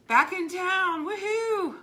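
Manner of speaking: high, loud, delighted welcome back; excited greeting. Real, not acted. Greeting Kim who is back in town, excited and high. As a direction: cheer for a friend back in town.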